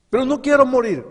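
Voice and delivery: high, loud cry; desperate sinner. Acted. Sinner crying to the Lord that he does not want to die, loud.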